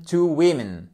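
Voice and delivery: WOMEN as WIMIN, two short i sounds; double short i. Shown as a demonstration. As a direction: say WOMEN with short i in both syllables. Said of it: "Double I court".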